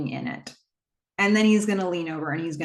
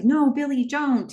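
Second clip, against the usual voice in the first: a touch of a frightened plea, pitched up; half-hearted imitation of a desperate cry; mild retold scream; 7 semitones higher, 3 dB louder than usual. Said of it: "He's screaming".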